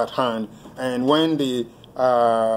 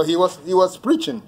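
ordinary talk, nothing marked

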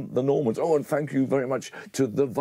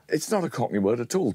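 ordinary talk, nothing marked

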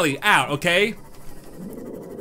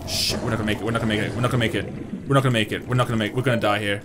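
low, repetitive anxious chant; doomed fretting; 6 semitones lower, 4 dB quieter, much faster than usual. Real, not acted. Player running out of oxygen underwater, fretting low. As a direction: repeat it low, in mounting dread.

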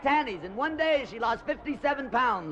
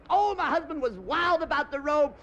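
gushing bride; 8 semitones higher than usual. Retold or imitated. Bride tells story.

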